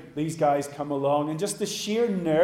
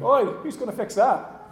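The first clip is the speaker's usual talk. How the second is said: raised, higher-pitched mock shout; 7 semitones higher, 5 dB louder than usual. Man below calling to the guys on the roof, shouting up. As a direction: call up to someone overhead, loudly. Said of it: "rather than shouting up at the guys on the roof".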